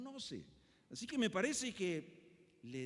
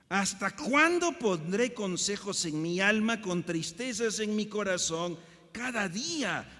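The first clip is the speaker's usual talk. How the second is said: loud, high, slow psalm lament; dramatic scripture reading; 4 semitones higher, 12 dB louder, slower than usual. Acted. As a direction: read the lament as a cry to heaven. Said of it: "El versículo dos tiene dos "hasta cuándo".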